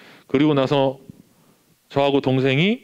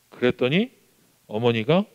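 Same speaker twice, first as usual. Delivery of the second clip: ordinary delivery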